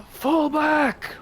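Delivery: high, loud battlefield shout; shouted command. Acted. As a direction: shout an order to the troops. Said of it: "I probably would shout".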